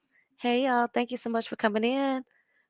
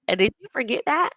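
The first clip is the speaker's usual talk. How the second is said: usual speaking voice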